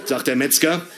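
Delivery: normal talking voice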